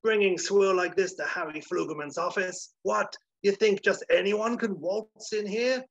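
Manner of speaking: high, loud, hammed-up studio boss tirade; Flugelman shouting. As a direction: tear into terrified writers with blustering outrage. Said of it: "he's shouting at some Ponzi types".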